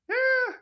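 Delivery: high, skeptical drawn-out "Eh"; a doubting "Eh" pitched far up; doubtful eh. Retold or imitated. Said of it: "whenever you get that sound".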